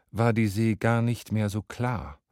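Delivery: plain reading voice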